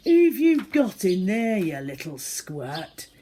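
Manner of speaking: loud, menacing shout, then a slow sneer; guttural bully. Acted. Tina Trotz yells at Zoe through the door. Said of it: "came a guttural voice".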